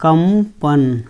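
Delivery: कंपन stretched out very slowly, each sound held; drawn out slowly. Shown as a demonstration. Teacher slowly spelling out कंपन for students. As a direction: say कंपन slowly, syllable by syllable, so the class hears it. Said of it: "देखो क्या बोलते हैं?".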